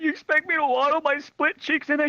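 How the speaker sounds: high-pitched mock outrage; indignant protest. Hurt kid protesting the walk to nurse, high whine. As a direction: squeal it as an incredulous question.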